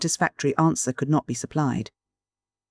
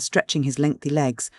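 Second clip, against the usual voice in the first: usual speaking voice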